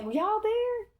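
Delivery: high, drawn-out call to the sky; high sing-out question; calling upward. Retold or imitated.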